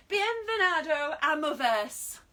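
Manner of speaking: loud, high and drawn-out Spanish greeting; shouted Spanish. Shown as a demonstration. Fitness instructor welcoming viewers in Spanish, shouted. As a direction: call it out slowly in over-enthusiastic Spanish. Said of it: "have to shout it".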